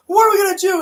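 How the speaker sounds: high-pitched, shouted mock alarm; retold panic. Retelling a zombie film to a friend, mock panic. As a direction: cry out in excited imitation of alarm.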